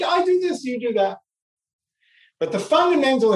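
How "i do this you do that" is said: higher, lightly mocking shrug of a voice; mock dismissive voice. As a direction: toss it off like someone brushing you aside. Voicing others' dismissive attitude.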